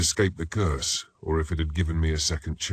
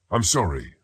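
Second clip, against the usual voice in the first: usual speaking voice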